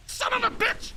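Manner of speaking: high shrieking curse at the game; frustrated outburst. Real, not acted. Gamer cursing at a failed jump, shrill yelp.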